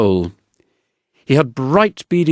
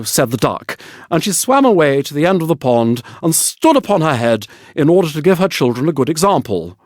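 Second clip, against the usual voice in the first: usual speaking voice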